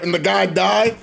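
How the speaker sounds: ordinary talk, nothing marked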